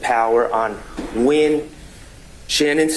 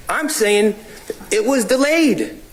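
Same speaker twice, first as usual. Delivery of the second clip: insistent argument; 9 semitones higher, faster than usual. Real, not acted. Lawyer arguing insistently with judge.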